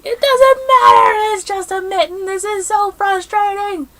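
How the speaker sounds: high, loud mock husband. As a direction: imitate him in a high squeaky voice. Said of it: "my husband's like".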